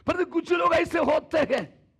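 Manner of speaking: high, loud preaching burst; emphatic call. Real, not acted. Preacher turning to warn about silent people, loud and high. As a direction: lift the voice to make the point.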